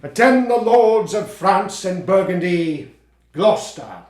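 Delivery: slow, loud, booming royal proclamation; grand Lear declamation. Acted. Actor rehearsing Lear's opening, booming and slow. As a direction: command the court like an old king, slowly.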